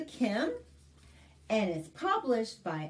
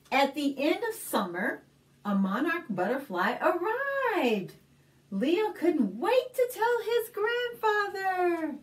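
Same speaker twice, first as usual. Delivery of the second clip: put-on clear, lively read-aloud for little kids; story narration; 5 semitones higher, 3 dB louder than usual. Librarian reading a picture book to kids, lively storytelling.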